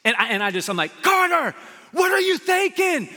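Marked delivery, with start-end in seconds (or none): 1.0-3.1 high, loud exasperated shout with drawn-out words; yelling. Retold or imitated. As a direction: re-enact a dad yelling at his kid from the van. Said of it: "I'm yelling from the van".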